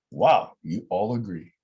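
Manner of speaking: ordinary talk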